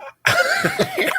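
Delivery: squeaky burst of laughter; high squealing laugh. Real, not acted. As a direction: let out a high shriek of laughter. Laugh before wife's line.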